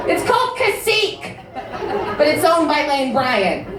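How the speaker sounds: high-pitched, hammed-up indignant correction; personally offended heckler. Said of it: "she was personally offended by that".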